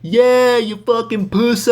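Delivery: high, drawn-out mock jeer; hammed-up audience taunting; heckler. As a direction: heckle from the crowd in a high, loud voice. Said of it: "anybody could say".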